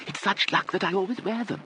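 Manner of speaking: put-on high, airy elderly lady voice; fluttery old aunt. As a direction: gush like a vague dotty aunt. Aunt Kit voice.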